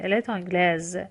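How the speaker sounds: تانگلز closing on a buzzed Z; French feminine "تانگلز" with audible z; S pronounced as Z. Shown as a demonstration. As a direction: let the Z sound at the end of تانگلز.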